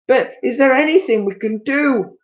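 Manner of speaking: wife pleading with her husband; Missus Twit crying out. Acted. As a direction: beg for a cure. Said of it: "cried Missus Twit".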